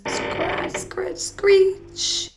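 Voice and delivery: high, scraping screech sounds; spooky noises. A narrator's reading. Narrator voices the scratching at the window, high and eerie. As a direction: scrape the words like branches.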